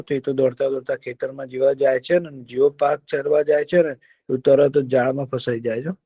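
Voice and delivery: usual speaking voice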